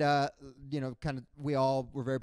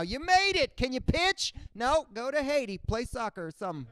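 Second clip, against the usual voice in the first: high, louder put-on official voice; hammed-up border guard; mock Coast Guard officer; 9 semitones higher, 4 dB louder than usual. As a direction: wave them through like a bored guard. Voices Coast Guard deciding refugees.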